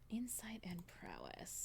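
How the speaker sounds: whispered to herself; breathy hushed whisper. Real, not acted. Whispered repeat to self.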